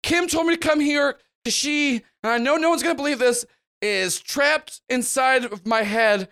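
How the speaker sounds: desperate bystander begging for his life; man begs the gang not to kill him; frantic plea. Acted. Lift blurts desperate plea.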